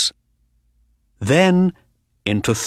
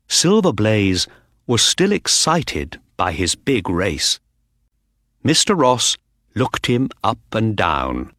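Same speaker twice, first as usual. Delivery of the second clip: ordinary delivery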